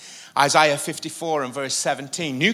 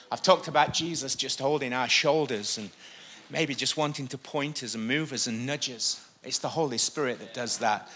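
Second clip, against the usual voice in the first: ordinary talk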